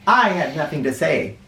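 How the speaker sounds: plain reading voice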